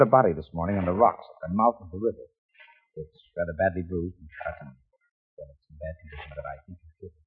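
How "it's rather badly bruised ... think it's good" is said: low, soft and hesitant; subdued, reluctant delivery of grim news; hushed bad news. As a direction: break it gently, dropping away. Breaking bad news gently.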